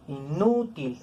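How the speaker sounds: "inútil" with NÚ drawn out and stressed; stress on penultimate syllable. Shown as a demonstration. Teacher giving an example of graves, "inútil" stressed on NÚ. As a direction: hit NÚ in "inútil". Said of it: "van a ser palabras graves".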